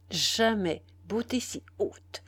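ordinary delivery, nothing marked

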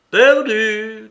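loud, high cry of loss; high, forceful outcry; shouted. A narrator's reading. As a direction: shout it out in one long burst. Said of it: "cria Mitya".

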